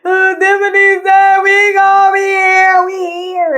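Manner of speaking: high, drawn-out tearful wail, loud then easing; imitated crying, long and high-pitched. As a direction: re-enact bawling through the words, stretched out high. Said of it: "What are you crying for?".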